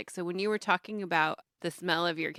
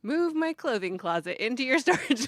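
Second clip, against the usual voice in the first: amused, higher and louder with laughter; laughing; 6 semitones higher, 7 dB louder than usual. Real, not acted. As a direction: giggle through the silly idea. Laughing at own joke.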